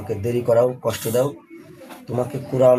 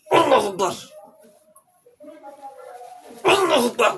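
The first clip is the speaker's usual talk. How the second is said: high ringing Allahu Akbar; calling out; 13 semitones higher than usual. Real, not acted. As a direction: call it out high and long. Exorcist calls out takbir. (উঁচু স্বরে তাকবির)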